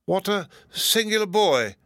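plain reading voice

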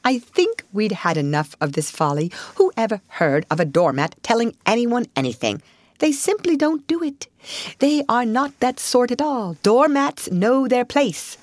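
peevish, rising to high-pitched indignation; pettish. A narrator's reading. Mole dismissing rat's doormat folly, pettish. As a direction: huff it out with prim certainty. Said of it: "said the mole quite pettishly".

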